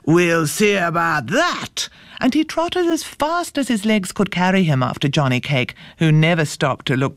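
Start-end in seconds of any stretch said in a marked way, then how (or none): 0.0-1.9 slow, menacing bear growl; big bear taking up a challenge. A narrator's reading. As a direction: growl the threat out slowly. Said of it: "growled the bear".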